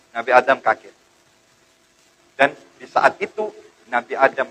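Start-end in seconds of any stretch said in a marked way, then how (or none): none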